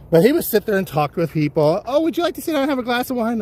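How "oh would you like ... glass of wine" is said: high, quick friendly invitation; mock gracious host. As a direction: offer the seat like a gracious celebrity. Actor voiced inviting fans.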